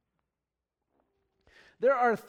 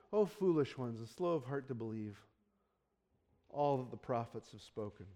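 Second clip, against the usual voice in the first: gentle rebuke; 8 semitones lower, 8 dB quieter than usual. Retold or imitated. Quoting Jesus gently.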